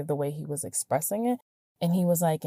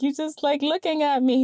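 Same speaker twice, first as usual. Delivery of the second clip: much higher-pitched mimicked voice; imitating his surprise; 10 semitones higher than usual. Man to his silent listener, high-pitched mimicked complaint. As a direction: pitch it right up, imitating him. Retold teasing quote from him.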